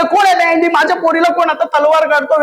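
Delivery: high, loud angry shout; furious dad. Retold or imitated. Dad shouting at a supposed intruder, furious. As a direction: bellow at the intruder.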